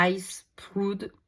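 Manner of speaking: slow English name in a French accent; unpolished French-accented English. Real, not acted. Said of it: "désolée pour mon accent anglais".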